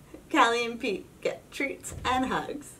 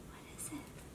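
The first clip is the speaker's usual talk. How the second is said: soft unvoiced whisper; 22 dB quieter than usual. A narrator's reading. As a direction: hush the question. Something glowing on the steps.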